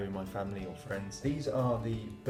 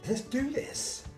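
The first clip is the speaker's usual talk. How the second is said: excited; 14 semitones higher, much slower than usual. Real, not acted. Psyching himself up to eat.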